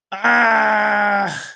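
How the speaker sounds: high, sustained wailing scream; mock scream. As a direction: cry out in exaggerated horror. Mock horror at learning Aquarius.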